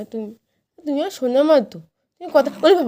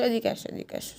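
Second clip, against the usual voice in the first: whispered; 10 dB quieter than usual. Real, not acted. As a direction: drop to an unvoiced whisper. Same call whispered.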